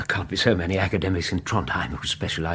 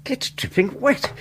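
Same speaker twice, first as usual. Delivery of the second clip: ordinary talk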